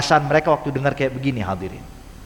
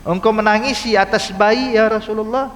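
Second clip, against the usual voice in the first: higher, baffled voice of a puzzled companion; incredulous bystander, pitched up; bewildered question; 6 semitones higher than usual. Retold or imitated. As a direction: ask in disbelief, pitched up high. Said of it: "ini bingung".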